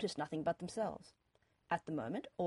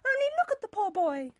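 high, loud melodramatic plea; mother's cry; 7 dB louder than usual. A narrator's reading. Mother pleading for her poor boy, crying out. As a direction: wail it in a raised voice. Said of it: "Mother cried".

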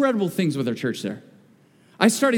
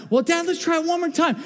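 put-on kids pleading; 10 semitones higher than usual.

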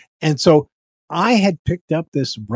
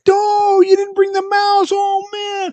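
mock outcry, very high and loud; retold loud complaint; 6 dB louder than usual. Disappointed fairgoers to puppeteer who came without mouse, loud mock complaint. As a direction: wail it as an imitated let-down fan.

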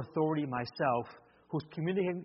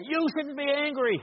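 higher, hammed-up yelling parent; mock angry yell; 9 semitones higher than usual. Shown as a demonstration. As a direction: snap at the kid like a losing-it dad. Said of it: "I can yell at him and say".

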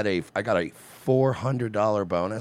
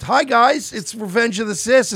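high, loud opening, easing down; hammed-up peppy show opener; mock chirpy greeting; 11 semitones higher, 5 dB louder than usual. Host greeting listeners in Florida heat, mock chirpy.

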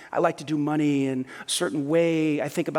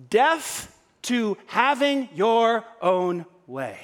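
slow weighty; 4 semitones higher, much slower than usual. Real, not acted. Preacher's central slow declaration.

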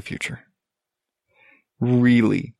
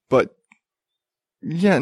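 usual speaking voice

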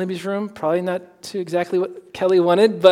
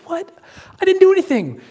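much higher, louder innocent protest; hammed-up what-did-I-do; mock indignant; 5 dB louder, faster than usual. [name] protesting to his friend, high mock indignation.

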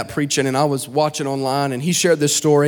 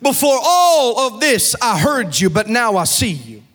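higher, sung-out preaching over music; full-force preaching; 6 semitones higher than usual. Acted. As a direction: lift the church, chant it like a climax.

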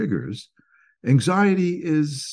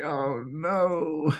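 higher, softer mock dismay; 7 semitones higher, 3 dB quieter than usual. Driver seeing red taillights ahead, high mock dismay. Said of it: "you say".

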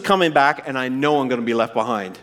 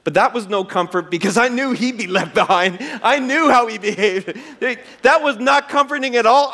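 ordinary talk

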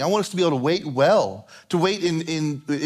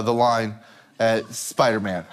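usual speaking voice

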